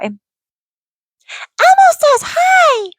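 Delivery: squeaky falsetto puppet voice; very high, thin, childlike squeal of a greeting; Elmo. Shown as a demonstration. Mother attempting a character her kids know, squeaky Elmo impression. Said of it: "I can't do Elmo very well".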